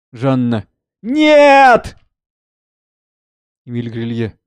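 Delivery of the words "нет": very high, loud, held cry; anguished scream of no. Acted. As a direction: cry out in refusal at news of a death. Mother told her son is dead. (отчаянный крик)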